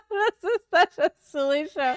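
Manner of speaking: loud, high, laughing disbelief; laughing reaction. Real, not acted. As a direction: exclaim through real laughter. Reacts laughing to a cartoon clip.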